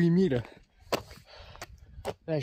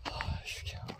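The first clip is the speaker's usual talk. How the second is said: whispering; 15 dB quieter than usual. Real, not acted. Hard climb, forcing calm.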